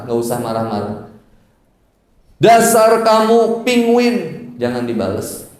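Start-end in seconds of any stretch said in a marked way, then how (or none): 2.4-4.2 high, drawn-out mock scolding; husband's exaggerated pitched-up taunt; angry husband. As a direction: hurl it slowly as a playful insult. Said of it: "Ketika istri dikatain penguin sama suami".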